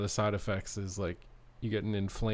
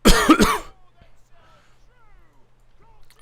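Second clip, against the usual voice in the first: coughing fit; 17 dB louder than usual. Real, not acted. Loud cough on stream.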